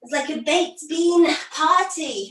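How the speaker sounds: ordinary delivery, nothing marked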